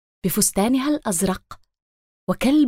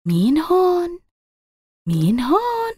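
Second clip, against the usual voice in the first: high, questioning calls; curious character voice; 6 semitones higher than usual. Acted. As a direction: peek around and ask who's there. Voice asks from the flowers.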